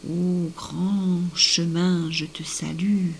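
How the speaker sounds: slow, drawn-out solemn hail; grand salutation. A narrator's reading. The girl greeting the sunken path, slow grand salutation. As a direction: stretch the greeting like an invocation.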